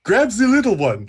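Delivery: high, loud call across the fight; yelling. Retold or imitated. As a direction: shout an order to a comrade. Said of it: "I yell to Pike".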